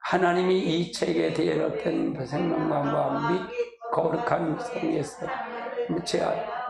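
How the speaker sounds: low, measured Bible reading over music; scripture reading. Acted. Preacher reading Revelation to the congregation, solemn recitation.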